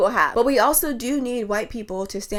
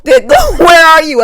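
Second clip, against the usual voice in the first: laughing shout; 8 semitones higher, 15 dB louder, much slower than usual. Real, not acted.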